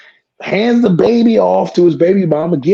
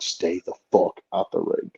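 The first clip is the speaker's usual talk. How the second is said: much softer, lower threat; grim; 9 semitones lower, 10 dB quieter than usual. Retold or imitated. Wrestler to opponent, soft low threat. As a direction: mutter it coldly. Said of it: "I'm like".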